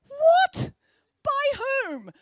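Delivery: very high, loud shocked teacher voice; shocked exclamation. A narrator's reading. Teacher hears the homework was eaten, high and loud. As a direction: recoil in disbelief.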